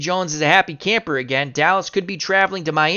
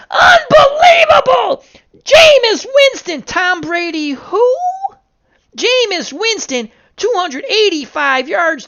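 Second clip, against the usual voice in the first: screaming, much higher and louder, near crying with joy; ecstatic call; 14 semitones higher, 7 dB louder than usual. Acted. Fan-announcer calling the Patriots' miracle win, ecstatic screaming. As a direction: lose it completely as the underdog wins.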